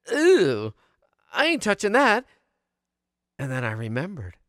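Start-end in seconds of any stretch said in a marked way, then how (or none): 0.1-2.3 higher, grossed-out "ew" and refusal; disgusted kid. Retold or imitated. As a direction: recoil from the moldy cheese. Child disgusted at moldy cheese.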